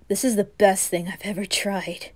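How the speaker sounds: usual speaking voice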